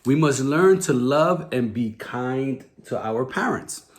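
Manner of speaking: ordinary delivery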